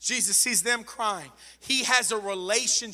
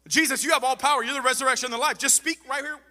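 raised, urgent preacher's voice, stumbling over words; fervent preaching plea; 4 semitones higher, 4 dB louder than usual. Acted. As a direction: plead with Jesus as a fired-up preacher.

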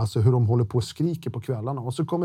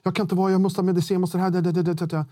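rapid, higher mock panicky pleading; imitated panic; 5 semitones higher, faster than usual. Inmate in the next cell begging for medicine, imitated panic. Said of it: "en del har panikattacker".

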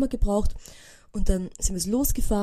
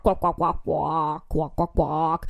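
imitated frog quacks, lower; frog croaks; 4 semitones lower, much slower than usual. Frogs calling back and forth in the pond, imitated croaks. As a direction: croak like frogs answering each other. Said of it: "der geht so Quack".

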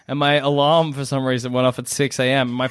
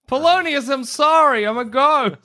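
hammed-up ghost apology; 8 semitones higher, 3 dB louder than usual. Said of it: "ghost apologizes to Polonius".

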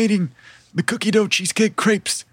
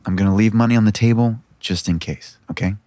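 low-voiced companion stepping away; much lower character voice; Caramel; 11 semitones lower than usual. Caramel telling the player she'll check on special orders, low-voiced. Game master plays Caramel.

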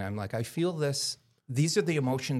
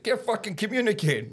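higher Italian-flavoured grumble; Italian roommate; 10 semitones higher than usual. Retold or imitated. Roommate with both arms in casts grumbles in a high voice. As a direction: play the frustrated Italian guy in casts. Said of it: "He's like".

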